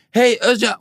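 calling back. Retold or imitated. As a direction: call to him as you're pulled off. She calls as she's pulled away.

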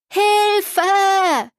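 high, distant call for help; a child's desperate cry from afar. A narrator's reading. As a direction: yell it from far out on the water. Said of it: "rief jemand aus Leibeskräften".

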